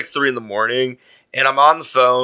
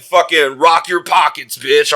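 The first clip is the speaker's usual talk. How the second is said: slow, raised mock-tough demand; retold robber's threat; 4 semitones higher, 4 dB louder, slower than usual. Would-be robber demanding from a passerby, mock-tough. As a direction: act out a street robber's order.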